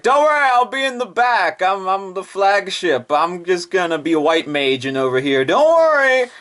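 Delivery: high, breezy put-on voice; Akashi's voice. Akashi hanging back from the fight, high and breezy. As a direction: reassure lazily. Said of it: "Nakashi's over here like".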